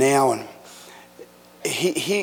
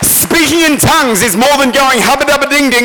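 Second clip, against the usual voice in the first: loud, high, driving sermon delivery; full-force preaching; 8 semitones higher, 9 dB louder than usual. Real, not acted. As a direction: build to a thundering pulpit climax.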